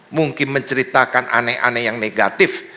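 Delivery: ordinary talk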